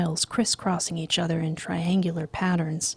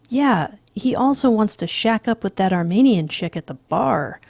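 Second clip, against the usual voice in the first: sneering; 4 semitones higher, 7 dB louder than usual. Acted. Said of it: "Price sneers".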